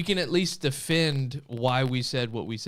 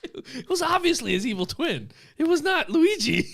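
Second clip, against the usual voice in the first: high-pitched comic exclamation; mock outraged protest; 5 dB louder than usual. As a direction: protest it in a squeal, hammed up for laughs. Mock protest repeating the meme.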